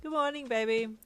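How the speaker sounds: loud, lifted cheery greeting; cheerful. A narrator's reading. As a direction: greet them brightly. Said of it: "Hawks said cheerfully".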